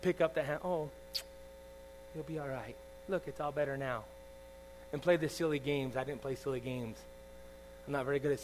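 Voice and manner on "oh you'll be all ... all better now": put-on soft, low soothing of a child; imitated comforting mom. Preacher as mom tending her son's hand, softly soothing. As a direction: hush a hurt child gently, as a mother would. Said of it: "That's why he had his mom".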